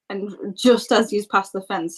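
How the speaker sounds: normal talking voice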